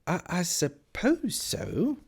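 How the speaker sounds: falter, then draw it out uncertainly; hesitant Alice. A narrator's reading.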